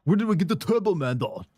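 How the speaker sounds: frantic Arnold asking; excited Austrian accent, urgent. Retold or imitated.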